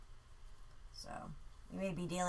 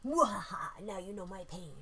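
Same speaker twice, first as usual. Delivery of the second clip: mad scientist. Retold or imitated. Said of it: "Tommy's laughing".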